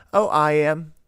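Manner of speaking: put-on high, breezy child voice; imitating a casual child. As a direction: say it like nothing's wrong. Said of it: "And Ellie's like".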